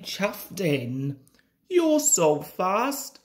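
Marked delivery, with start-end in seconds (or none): 1.7-3.2 high, slow, impressed wheeze; wheezed admiration. A narrator's reading. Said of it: "wheezed Rheneas".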